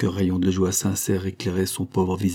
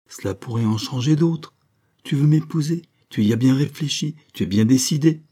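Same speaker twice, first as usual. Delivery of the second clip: higher, louder joyful outpouring; overcome with joy; 6 semitones higher, 3 dB louder than usual. A narrator's reading. Marianne pours out her joy to Prosper, higher and louder. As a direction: let the happiness spill over. Marianne checks his resolve.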